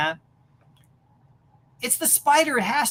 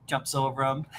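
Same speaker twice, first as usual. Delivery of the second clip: ordinary delivery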